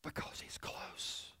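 breathy whispered, slow; hushed whisper, very close. Shown as a demonstration. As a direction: whisper it as if he's right beside you. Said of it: "He whispers".

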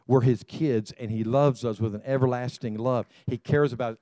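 ordinary talk, nothing marked

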